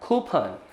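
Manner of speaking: कूपन said as खूपन with breathy ख; कूपन with an aspirated first sound; correct pronunciation. Shown as a demonstration. Teacher drilling students on खूपन. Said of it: "हमें क्या लेना है".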